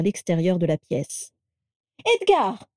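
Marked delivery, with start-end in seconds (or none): 2.2-2.7 high, sharp summons; roaring. A narrator's reading. Carla catches her husband slipping away, roaring. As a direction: call him to heel, pitched up. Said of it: "rugit Carla".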